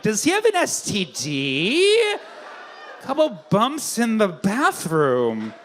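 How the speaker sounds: slow, drawn-out mock puzzlement; puzzled audience member. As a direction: stretch it out like a clueless onlooker. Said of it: "some of you are like".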